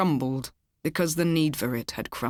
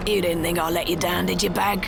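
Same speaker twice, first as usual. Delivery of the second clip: lightly voiced rough driver; a hint of a cheerful thug; pockmarked kidnapper. A narrator's reading. Kidnapper greets Millie in the car, lightly voiced thug.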